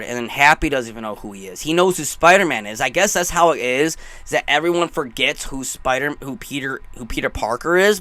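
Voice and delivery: ordinary talk, nothing marked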